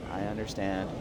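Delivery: low, soft I understand; timid mumble. Acted. New inmate answering the captain, soft and timid. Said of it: "Speak up".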